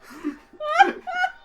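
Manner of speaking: high laugh close to tears; squealing laughter; high wheezing laugh. Real, not acted. Laughing at the username.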